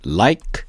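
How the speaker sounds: "like" with the k released alone after a gap; hold, then puff out the k of "like"; no added vowel. Shown as a demonstration. Teacher models the ending, bare k on "like". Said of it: "이런 식으로".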